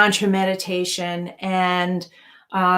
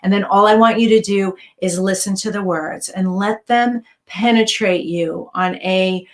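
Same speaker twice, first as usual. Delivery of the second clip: normal talking voice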